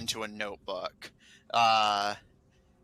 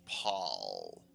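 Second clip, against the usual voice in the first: name said oddly, much lower and quieter; strange way; 9 dB quieter than usual. Acted. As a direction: say Paul like it sounds foreign to you. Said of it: "He says it in a kind of strange way".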